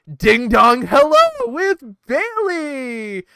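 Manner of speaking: slow, loud mock fanfare; sarcastic announcement. Real, not acted. Sarcastically introducing the worst segment to listeners, mock fanfare. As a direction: announce it like a dreaded treat.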